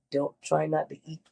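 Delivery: ordinary talk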